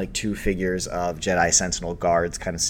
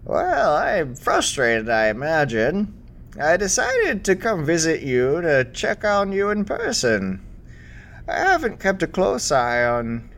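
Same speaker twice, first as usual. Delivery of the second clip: put-on higher, kindly old woman voice; elderly Jedi master; 4 semitones higher than usual. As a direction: speak as a gentle aged mentor.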